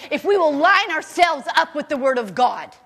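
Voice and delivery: ordinary delivery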